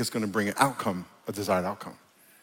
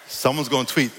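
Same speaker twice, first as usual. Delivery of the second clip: ordinary talk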